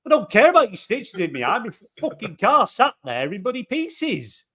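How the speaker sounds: telling off the driver who hit his car, exasperated; indignant retort. Retold or imitated. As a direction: throw it back at her, fed up. Retold argument with old driver.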